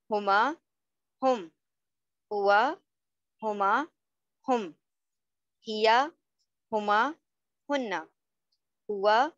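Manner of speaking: measured table recitation, a pause after each group; repeat-after-me drill. Shown as a demonstration. Teacher leading students to memorise the table, repeat-after-me drill. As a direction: recite word by word, lilting up, then closing each set. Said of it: "साथ साथ हमारे repeat करना है".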